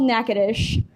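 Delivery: Natchitoches said "NACK-uh-tish"; Natchitoches with a hard k and final sh, "NACK-uh-tish"; local pronunciation. Shown as a demonstration. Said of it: "That first one is called".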